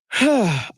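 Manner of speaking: big audible sigh; exasperated sigh. Real, not acted. Radio host done with the media gripe, exasperated sigh. Host sighs before moving on.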